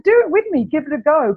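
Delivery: an eager high invitation to try chanting; inviting. Retold or imitated. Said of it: "I'm like".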